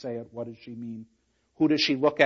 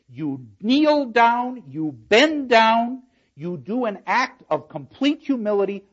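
slow, drawn-out and louder; slow emphatic command; 7 semitones higher, 5 dB louder, slower than usual. Shown as a demonstration. Lecturer tells the class how Kate kneels, slow and emphatic. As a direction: lean hard on each kneeling command. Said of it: "demonstrates humility".

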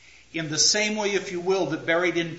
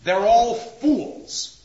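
slow, loud, higher verdict; judging Macbeth; 4 semitones higher, 7 dB louder, much slower than usual. Acted. Lecturer voices Macbeth judging everyone, loud and slow. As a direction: pass judgment, drawing out each word. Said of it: "here is Macbeth doing what? Judging".

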